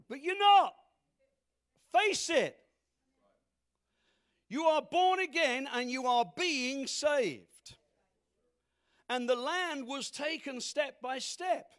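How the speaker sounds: slow, hammer each word home; full-force preaching. Acted. Pastor telling the congregation to face their failure, loud emphatic preaching.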